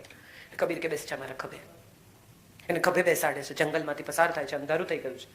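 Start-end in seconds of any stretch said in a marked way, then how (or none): 0.9-1.6 soft, gentle invitation; quiet, tender reassurance; tender offer. Acted. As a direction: softly offer your shoulder. Kabir tenderly offers shoulder.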